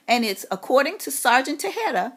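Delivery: usual speaking voice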